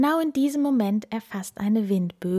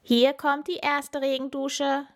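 higher playful elephant announcing; 7 semitones higher than usual. A narrator's reading. Elephant teasing the friends about the rain, high and playful. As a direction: tease them with the first shower.

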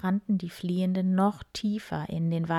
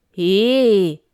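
loud indignant boar's shout, louder; loud protest; 11 dB louder than usual. Acted. Boar Wildi protests the pig joke, loud indignant shout. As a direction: bark out the protest. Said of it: "protestierte Wildi lauthals".

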